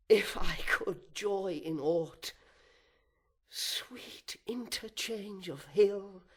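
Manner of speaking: slow wistful lament, voice straining high; aching plaint. A narrator's reading. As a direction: linger over each word as if the joy is lost to him. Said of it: "his bursting passion into plaints thus poured".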